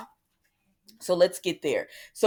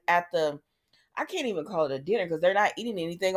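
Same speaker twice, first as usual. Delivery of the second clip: ordinary delivery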